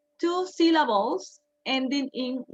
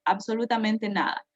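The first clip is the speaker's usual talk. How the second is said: ordinary talk, nothing marked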